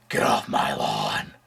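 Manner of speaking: put-on very low, slow gravel-free growl of an old man; Clint Eastwood impression. Cranky old man yelling at kids off his lawn, very low and slow. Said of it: "That's my Clint Eastwood impression".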